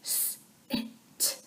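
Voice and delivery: S-it split into its sounds; sounding out words. Shown as a demonstration. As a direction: say S-it sound by sound, softly. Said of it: "would sound out sit".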